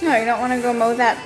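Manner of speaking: ordinary delivery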